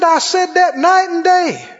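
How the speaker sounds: high, loud excited testimony; retold elation. Changed man testifying to the preacher, excited. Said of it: "He was lit up".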